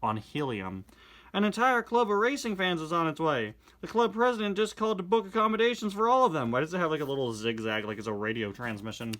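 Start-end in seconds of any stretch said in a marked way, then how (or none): 1.4-6.5 louder, higher character reading; animated reading of the twins and Sally; comic dialogue read-aloud. Acted. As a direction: perform the comic lines with energy.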